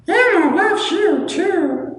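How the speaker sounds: slow, very high puppet falsetto; Elmo. Acted. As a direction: squeak it out slowly like a kids' puppet. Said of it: "Oh, we do Elmo".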